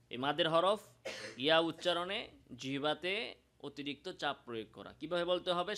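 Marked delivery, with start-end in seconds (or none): none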